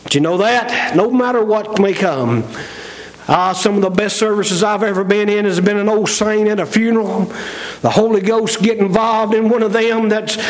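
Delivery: normal talking voice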